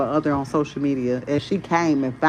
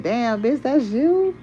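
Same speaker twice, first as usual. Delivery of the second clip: high, incredulous mock squeal; imitating her own shocked reaction, rising to a high squeak; shocked disbelief; 8 semitones higher, slower than usual. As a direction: climb up in pitch as if you can't recognize yourself. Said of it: "I be looking like".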